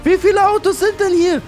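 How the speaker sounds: very high, loud, hurried exclaiming; frantic disbelief. Real, not acted. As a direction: shout in alarm at being swarmed. Swarmed by cars while escaping. (hektisch, schrill vor Aufregung)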